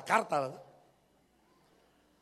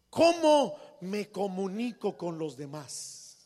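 ordinary talk

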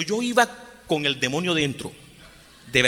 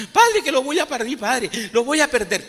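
put-on high, pleading panic over losing the baby; a frightened pregnant girl begging the priest, imitated; imitated desperate crying; 8 semitones higher, 4 dB louder than usual. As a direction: wail it up high, desperate and weeping. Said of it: "y lloraba y lloraba".

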